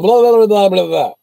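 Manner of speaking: high, loud rattled-off blah-blah; imitating a fast-talking ticket agent; mock agent gibberish. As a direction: rattle off nonsense like a rushed phone agent. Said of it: "like that".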